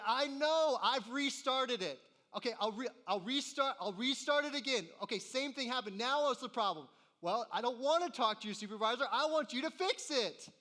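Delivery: high, loud, fed-up ranting at the agent; exasperated caller. Retold or imitated. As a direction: vent at an incompetent support agent. Arguing with customer service agent.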